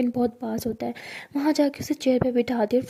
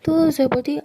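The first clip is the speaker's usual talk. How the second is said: usual speaking voice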